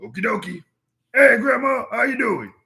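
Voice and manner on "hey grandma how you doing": calling out. Acted. Bug calls out to grandma.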